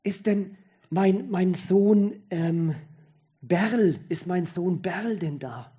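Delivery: a lightly voiced dying father calling for his children, slow and strained; weak old man straining to find his family. A narrator's reading. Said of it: "mit leiser und verzogener Stimme spricht er".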